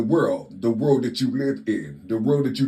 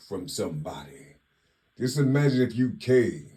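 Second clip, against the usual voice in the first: normal talking voice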